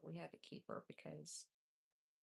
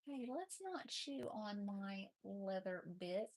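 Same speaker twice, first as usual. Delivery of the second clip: normal talking voice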